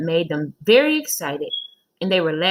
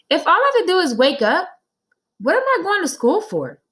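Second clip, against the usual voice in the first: put-on high, loud protesting kid; child Joe; 11 semitones higher, 5 dB louder than usual. Boy insists to his teacher he counted right, high and indignant.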